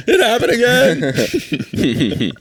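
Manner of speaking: high, drawn-out comic dismay; hammed-up nightmare waking. Comedian waking in a cold sweat, mock dismay. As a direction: wail it slowly through the laughter.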